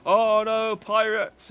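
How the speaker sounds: high, loud hammed-up panic; mock alarm. Mimicking theme park ride actors, loud hammed-up mock alarm. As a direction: ham up fake theme-park fright. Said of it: "it's just like".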